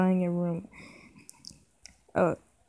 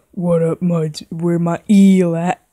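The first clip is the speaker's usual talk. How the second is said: gruff character voice; 8 dB louder than usual. Acted. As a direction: greet the crew like a bro. Mermaid character voiced loudly.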